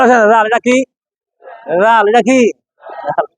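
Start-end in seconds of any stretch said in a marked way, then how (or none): none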